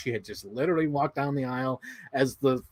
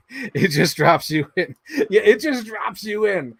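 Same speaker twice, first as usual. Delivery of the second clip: amused, laughing through the words; 6 semitones higher, 3 dB louder than usual. Real, not acted. Streamer agreeing with a viewer's comment, real laughter.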